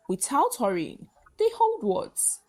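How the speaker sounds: ordinary delivery, nothing marked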